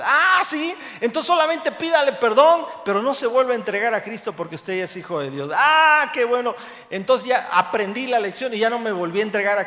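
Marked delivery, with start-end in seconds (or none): 0.0-5.5 high and loud, eager, like a pastor sure of the answer; animated retold advice. Preacher retelling a pastor's advice to him, high and loud. 5.5-6.5 loud and high, delighted relief; a happy "ah, how nice" of relief; relieved exclamation. Retold or imitated. Preacher recalling his relief at the pastor's answer, loud and high.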